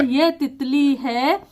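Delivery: words laid out very slowly, one by one; slow dictation. Shown as a demonstration. Teacher reading worksheet sentences aloud, slowly. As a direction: read each sentence as if a child copies it. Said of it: "बच्चे को वाक्य बनवाएंगे".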